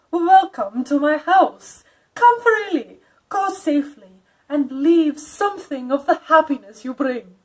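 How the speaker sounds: a stately, uncanny invitation; Dracula's welcome. Acted. Count Dracula welcomes Jonathan again, grand and eerie. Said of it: "with a strange intonation".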